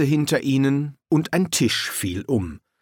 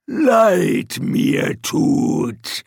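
slow troll; 7 semitones higher, 4 dB louder, much slower than usual. A narrator's reading. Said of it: "erwiderte der Troll".

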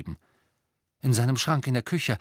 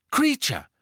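sharp, much higher, louder command; 4 dB louder than usual. A narrator's reading. Harry sharply commanding the trembling elf. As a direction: snap his name. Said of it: "sagte Harry scharf".

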